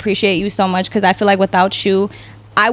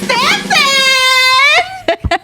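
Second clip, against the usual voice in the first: big high hype introduction; announcer intro; 8 semitones higher, much slower than usual. Acted. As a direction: announce him like a star. Announcing the guest.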